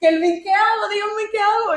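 high-pitched retold panic; lightly hammed-up nervous plea; mock scared pleading. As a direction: imitate a frightened friend asking for orders. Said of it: "estaba cagadísimo".

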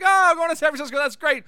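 hammed-up cheery "Oh, I'm going"; mock relieved reaction. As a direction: echo the news with amused relief. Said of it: "everyone starts laughing".

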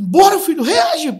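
very high, very loud cry to his son; desperate shout. Retold or imitated. Father at the ambulance door screaming at his son to react. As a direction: scream it at the boy to fight. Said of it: "eu grito".

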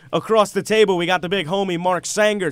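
ordinary talk, nothing marked

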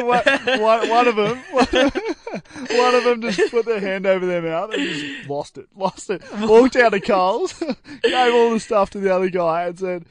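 high, loud, struggling through laughter; real laughing. Real, not acted. Friend retelling how customers lost it at Coles, laughing. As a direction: crack up while telling the story. Cracks up telling the checkout story.

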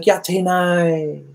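put-on a long, sing-song no from the girl; girl turning him down coyly, dragged out slowly and high; drawn-out refusal. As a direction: stretch it slowly and high, coy and reluctant. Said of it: "She's like".

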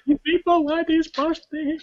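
very high, slow wailing mock sob; mock crying mum. Mum sobbing with relief to the store staff, in a high mock wail. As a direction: wail it like a mother in tears. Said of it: "she's crying like".

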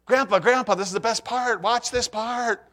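lightly voiced grandkid pleading; excited grandkid. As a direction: tug at him to watch, slowing on the last words. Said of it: "watch a show with the grandkids".

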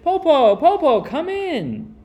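put-on high loud happy children calling; excited shout. A narrator's reading. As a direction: shout gleefully, slowing on come in. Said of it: "They shouted".

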